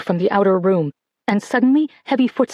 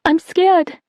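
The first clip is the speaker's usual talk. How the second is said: high, small frightened plea; a scared little girl begging; whimpering child; 9 semitones higher than usual. A narrator's reading. Little sister begs her not to leave, whimpering. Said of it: "Liliane whimpered".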